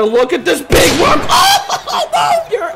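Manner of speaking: giddy laughing shout; laughing excitement. Real, not acted. Laughing at huge run.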